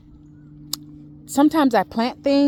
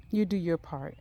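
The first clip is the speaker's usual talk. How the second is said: soft echo; 7 dB quieter than usual. Real, not acted. Echoing her advice to the viewers, softly. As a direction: repeat "You do your part" softer, almost to herself.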